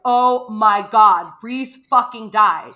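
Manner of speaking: a retold gasp of horror at the death; shocked gasp. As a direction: drag out the disbelief, loud and stunned. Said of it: "gasping, hand over mouth".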